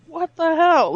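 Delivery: high, loud retold outburst; retold disbelief. Recalling the alarm blaring again, high incredulous outburst. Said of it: "And I'm like".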